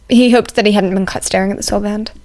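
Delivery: usual speaking voice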